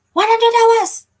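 loud, high cry of disbelief; astonished shout; shocked exclamation. Real, not acted. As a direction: blurt it out, high and stunned. Shocked repeat of a viewer's figure.

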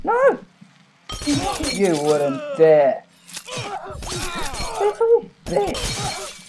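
high outraged yell; outraged shout. Real, not acted. Friend threatens to hang up.

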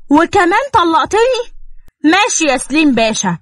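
woman spitting sarcasm after being divorced; shocked sarcasm. A narrator's reading. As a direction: sneer at him, stunned. Shocked woman mocking Salim.

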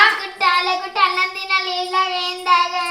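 loud sing-song wail; crying tantrum. Acted. Child threatening not to eat, wailing. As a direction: wail stubbornly through the tears. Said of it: "ఎందుకు ఏడుస్తున్నావు".

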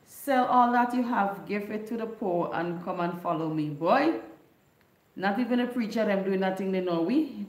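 ordinary talk, nothing marked

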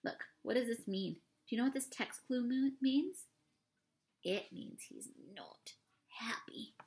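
soft, fast murmured questions; hushed asides. Real, not acted. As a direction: lean in and ask quietly. Quiet asides to listener.

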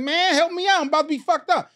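high, pleading mock voice for help; desperate plea. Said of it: "You're like".

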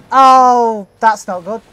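ordinary talk

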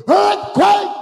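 high, loud shout of alarm; explosive high preacher's shout; shouted cry. Acted. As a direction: cry it out from the pulpit at full force. Cries out the quake at climax.

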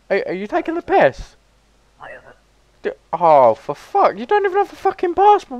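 high, loud exasperated tirade; loud high-pitched outraged ranting; incredulous rant. Real, not acted. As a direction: rant in disbelief at the fool. Ranting at passportless entrant.

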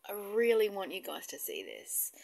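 whispered, confiding; hushed whisper. Real, not acted. Vlogger confiding to her viewers in a whisper. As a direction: lean in and whisper it. About to show something moving.

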